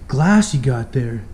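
normal talking voice

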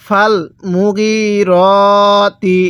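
মুগিরতি drawn out on long "ee" and "o" vowels; slow Quran recitation. Shown as a demonstration. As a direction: chant it very slowly, holding each long vowel. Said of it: "আরেকবার পড়ুন".

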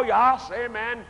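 ordinary talk, nothing marked

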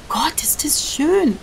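slow, breathless whisper of wonder; awed whisper. Real, not acted. Gamer marvelling at the beautiful landscape, slow whisper. Admiring game scenery.

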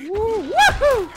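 loud, high, drawn-out woohoo; celebratory whoop. Real, not acted. Player cheering his luck, celebratory whoop.

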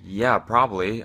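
normal talking voice

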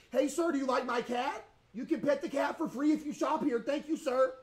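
over-friendly store clerk; cheery shopkeeper voice. Acted. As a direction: greet the customer like an eager cashier. Pitches the cat to customer.

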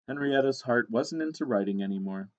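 ordinary delivery

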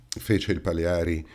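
ordinary delivery, nothing marked

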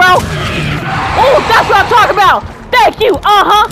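high, loud, gloating cheer; triumphant crowing. Real, not acted. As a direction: crow loudly over a win. Crowing as the animal returns.

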